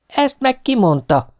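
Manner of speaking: high, slow, pointed question; challenging inquiry. A narrator's reading. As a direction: draw out the question, pitched high. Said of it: "érdeklődött".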